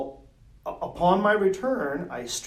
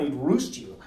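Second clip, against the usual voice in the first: ordinary talk, nothing marked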